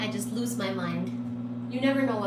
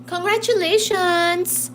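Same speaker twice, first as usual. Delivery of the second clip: cheerful; 5 semitones higher, 7 dB louder than usual. Real, not acted. Cheerful loud congratulations.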